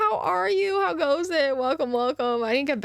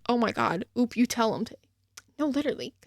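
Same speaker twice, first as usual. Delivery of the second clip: ordinary talk, nothing marked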